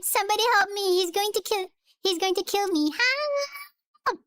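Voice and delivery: high pleading cry for help; panicked plea. Acted. Steven begging anyone to save him from Calloway, panicked. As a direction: beg for rescue, voice pitched high.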